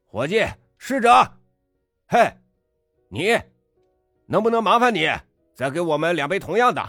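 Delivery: high, loud sarcastic shout. A narrator's reading. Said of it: "又嚷了起来".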